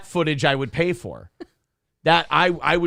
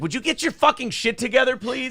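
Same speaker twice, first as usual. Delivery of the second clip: annoyed yell; 9 semitones higher than usual. Retold or imitated. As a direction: snap at her from the front seat. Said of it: "I was like".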